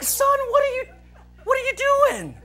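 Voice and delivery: high-pitched, incredulous questioning; acted-out baffled exasperation; exasperated. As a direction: squeal it like you cannot believe your friend. Exasperated at friend's gibberish.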